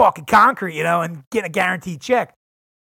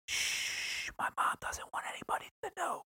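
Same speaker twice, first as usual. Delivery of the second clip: hushed secret whisper; conspiratorial whisper; 10 semitones higher, 18 dB quieter than usual. Real, not acted. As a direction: breathe it like a secret nobody should hear. Secret about smoking weed with mom.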